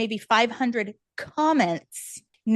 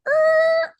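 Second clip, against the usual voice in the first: high screeching stop; 5 dB louder than usual. Retold or imitated. Showing her life crashing, a sharp high screech. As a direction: make the crash sound.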